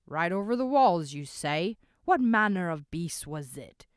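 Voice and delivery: loud, demanding; a rough man interrupting loudly; rough interruption. A narrator's reading. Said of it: "the rough voice interrupted again".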